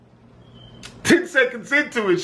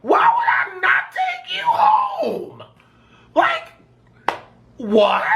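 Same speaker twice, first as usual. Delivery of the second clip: incredulous falsetto; hammed-up shocked squeak; squeaky disbelief; much slower than usual. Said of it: "So I'm like".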